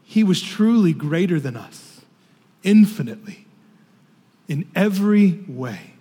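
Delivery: ordinary talk, nothing marked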